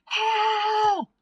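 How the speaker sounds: long, high, loud despairing cry; mournful cry. Acted. Raven trapped behind the door, crying out mournfully. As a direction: wail like he's given up. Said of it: "The cry is mournful".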